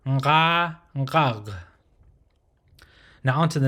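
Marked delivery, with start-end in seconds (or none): 0.1-1.5 "nga" held open, "ngag" closed at the throat; nasal onset on "nga" and "ngag"; guttural nasal demonstration. Shown as a demonstration. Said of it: "that's how you pronounce nga".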